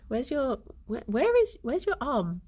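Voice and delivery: ordinary talk, nothing marked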